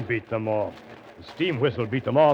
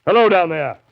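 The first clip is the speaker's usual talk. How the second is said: loud, high hail to someone below; calling down; 8 semitones higher, 9 dB louder than usual. Acted. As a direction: call out down to the shore. Hailing a figure on the wharf.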